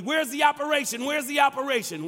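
high, quick, insistent call; mock a colleague's repeated demand; imitating John. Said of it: "John would be".

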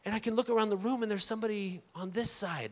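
ordinary talk

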